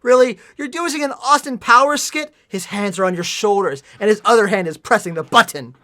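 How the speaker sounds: high, very loud incredulous rant; exasperated shouting; outraged. Real, not acted. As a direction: yell the contradiction back at the author. Outraged at plot error.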